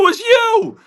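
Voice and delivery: very high, excited cry of recognition; acting out gleeful disbelief; astonished exclamation. Acted amazement at the actor.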